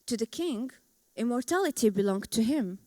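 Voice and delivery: measured epic verse, lines set apart by pauses; poem recital. Acted. Speaker presenting own Gilgamesh poem to an audience, solemn verse recital. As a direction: recite it like an ancient epic, drawing out key words.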